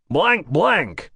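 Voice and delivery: producer naming himself, lightly American; a hint of an American accent. Producer introducing himself on the phone, lightly American. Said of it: "work a lot harder on that American accent".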